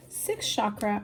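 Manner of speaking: ordinary delivery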